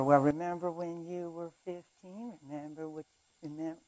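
hushed mock tempter; whispering enemy. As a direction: murmur an old accusation into someone's ear. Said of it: "imagine the enemy sitting on my shoulder".

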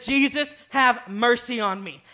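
high, loud desperate cry, slow at the end; screaming for mercy. Retold or imitated. Beggar screaming to Jesus past the crowd, loud and desperate. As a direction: scream his name to be heard. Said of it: "He kept screaming this".

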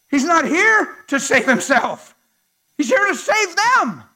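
loud, soaring preacher's cry; full-throated pulpit preaching; preaching at full force. Real, not acted. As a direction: preach it out to the whole room, building.

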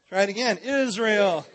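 usual speaking voice

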